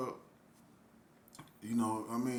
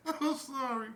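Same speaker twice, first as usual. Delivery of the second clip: high, laugh-choked apology; holding back laughter; 8 semitones higher than usual. Real, not acted. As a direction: scold yourself while fighting real giggles. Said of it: "I don't mean to laugh".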